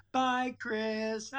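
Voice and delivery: bye pitched high and breaking into laughter; a chuckling, mocking farewell from a grinning dad; laughing teasing goodbye. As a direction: imitate the dad again while cracking up. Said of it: "waving at me and laughing".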